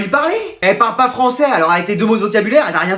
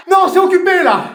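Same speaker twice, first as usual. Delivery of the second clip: high-pitched shouted refusal; petulant shout; 8 semitones higher than usual. Acted. Teacher hiding in the toilets from his class, high petulant shout. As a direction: shout them away like a stubborn child.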